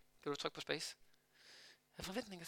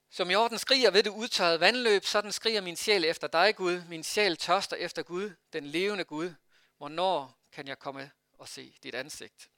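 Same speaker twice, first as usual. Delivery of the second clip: scripture reading; 5 dB louder than usual. Acted. Reads the psalm aloud.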